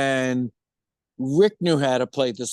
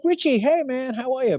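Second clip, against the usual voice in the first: excited greeting; 10 semitones higher than usual. Retold or imitated. Greeting old friend on phone.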